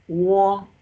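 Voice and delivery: "wo" held high and level, loud; sustained high, even "wo"; first tone. Shown as a demonstration. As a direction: hold "wo" at the top of your pitch. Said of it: "The first tone is high pitch".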